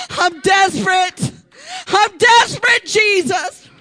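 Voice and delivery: high, loud desperate preaching; shouting prayer in the pulpit; full-force preaching. Acted. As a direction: preach it out like a cry for help.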